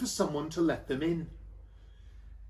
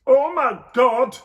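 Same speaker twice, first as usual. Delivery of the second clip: slow, higher, loud disgusted cry; disgusted Joseph; 7 semitones higher, 10 dB louder, slower than usual. Acted. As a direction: recoil from the smell. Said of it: "said Joseph".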